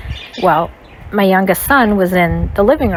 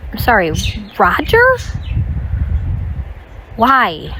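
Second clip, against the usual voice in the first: stunned, can't believe the name she just heard; stunned disbelief; 3 semitones higher than usual. Retold or imitated. Shocked at the cheating accusation.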